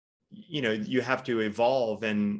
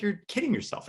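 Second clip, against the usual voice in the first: much higher, dismissive jab; mock business partner; 11 semitones higher than usual. Partner dismissing Ben's self-image, mock high voice. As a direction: scoff it like the partner would. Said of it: "He's like".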